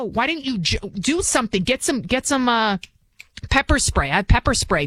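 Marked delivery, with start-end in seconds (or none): none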